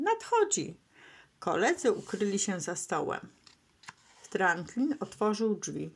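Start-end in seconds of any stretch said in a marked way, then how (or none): 0.0-0.8 very high, urgent announcement; excited warning call. A narrator's reading. Turtle warns hiding friends of the skunk, excited call. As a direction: pitch leaps in anticipation. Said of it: "zawołał Franklin".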